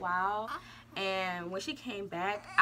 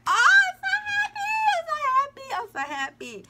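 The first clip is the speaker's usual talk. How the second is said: new mother squealing with joy; overjoyed squeal; 13 dB louder than usual. Retold or imitated. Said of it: "I was like".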